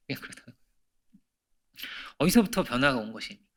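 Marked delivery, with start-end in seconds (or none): 0.1-0.6 breathy hushed aside; whisper. Real, not acted. Preacher whispering to the congregation about human weakness. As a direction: let it fall to a whisper.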